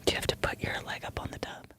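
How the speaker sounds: hushed mock ASMR; ASMR whisper. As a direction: close whispered question into the mic. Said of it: "do a little E S M R".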